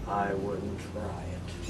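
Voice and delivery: deadpan it low from the couch; a groggy drunk warning from the couch, low and slow; drunk Gordon's warning. Retold or imitated. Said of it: "Gordon's laying there and he goes".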